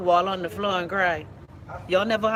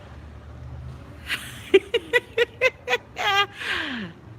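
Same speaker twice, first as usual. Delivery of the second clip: long high laugh; 13 semitones higher than usual. Real, not acted. Laughing at own story.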